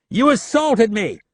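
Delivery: high, slow, loud near-scream; half scream it. A narrator's reading. Leland half screams at Rapp, high and loud. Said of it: "Leland half screamed".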